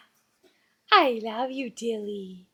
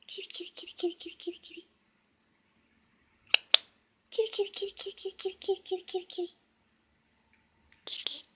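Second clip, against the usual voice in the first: soft whispered kitty-kitty chant; whispering; 3 semitones higher, 12 dB quieter, much faster than usual. Real, not acted. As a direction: whisper it over and over to coax. Whispered coaxing chant to bird.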